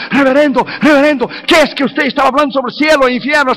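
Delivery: high, urgent pleading; mock panicked cry. Terrified passenger begging the reverend about heaven and hell, mock panicked cry. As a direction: plead in acted alarm, pitch jumping up. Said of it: "empezó a gritar".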